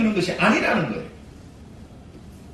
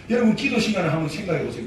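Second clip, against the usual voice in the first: normal talking voice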